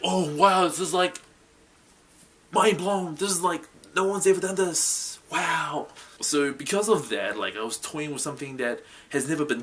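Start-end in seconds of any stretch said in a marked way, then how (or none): 0.0-5.9 excited. Retold or imitated. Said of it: "I was like".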